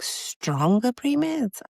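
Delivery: high, slow disbelieving repeat; incredulous echo. Retold or imitated. Mother echoing the doctor's suggestion, incredulous. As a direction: stretch it out, stunned.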